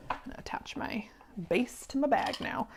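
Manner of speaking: soft whisper to herself while working; whispered aside. Real, not acted. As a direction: murmur it under your breath, just narrating the task.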